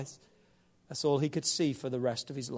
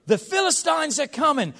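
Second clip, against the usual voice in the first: mock alarm; 12 semitones higher, 7 dB louder than usual. Delilah shouts a warning.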